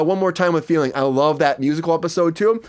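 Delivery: ordinary talk, nothing marked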